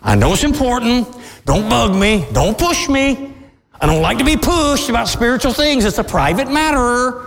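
a touch of a defensive procrastinator; procrastinator's brush-off. Retold or imitated. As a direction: lightly play someone waving God off. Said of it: "You'll say, you will procrastinate".

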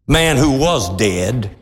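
usual speaking voice

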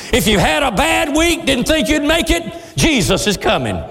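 high, rising preacher's cry; fervent preaching. Acted. Preacher comforting a troubled congregation, fervent high preaching. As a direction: lift their spirits and press the hope home.

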